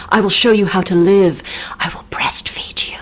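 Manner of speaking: whispered creepy come-on; mock seductive whisper; breathy whisper. Henry voiced in mock seduction.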